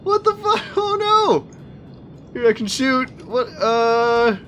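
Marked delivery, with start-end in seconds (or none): 0.0-1.5 high squeak of disbelief breaking into a laugh; shocked laugh. Real, not acted. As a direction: cut yourself off mid-curse, then laugh in shock. Game glitch shocks the player.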